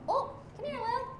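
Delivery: cooing softly to wake a sleeping animal; coaxing pet talk. Real, not acted. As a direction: gently coax a sleeping pet awake.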